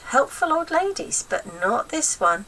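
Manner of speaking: usual speaking voice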